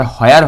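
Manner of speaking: "হোয়ার" with a sounded H, ho-ar; wrong pronunciation. Shown as a demonstration. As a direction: open "হোয়ার" on H before the glide. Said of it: "এটা হোয়ার হবে না".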